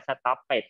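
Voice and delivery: "stop it", the -ed as an extra beat; stopped misread in two syllables. Shown as a demonstration. Teacher rejects the pupil's reading, "stop it" in two syllables. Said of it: "มีสองพยางค์ ไม่เอานะ stop it น่ะ".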